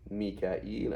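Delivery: "Mikael" said as mee-ka-eel; long -eel version. Shown as a demonstration. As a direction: end "Mikael" on a separate, rising -eel. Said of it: "there's three ways of saying it".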